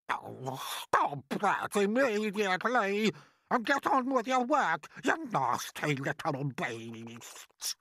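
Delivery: high, loud scolding aunt; harsh order. Acted. Aunt ordering the crying boy back to work, harsh scolding. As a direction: order the child about, stretching the final insult. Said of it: "Aunt Sponge ordered".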